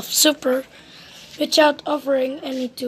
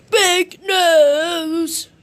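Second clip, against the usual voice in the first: rude shout; 4 semitones higher than usual. A narrator's reading. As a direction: bellow the insult like a brat. Mister Rude blurts insult.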